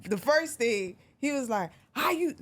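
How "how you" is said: a higher mock [name] snap; imitating [name]. Guest's rival imitated, higher mock anger. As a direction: imitate the rapper's angry opening. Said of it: "try to say it as best as he said".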